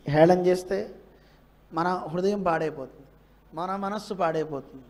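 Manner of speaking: ordinary talk, nothing marked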